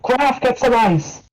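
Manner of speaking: high, urgent call across the diner; shouting for ice. Acted. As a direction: shout to her husband in the kitchen, slowly. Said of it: "she'll shout".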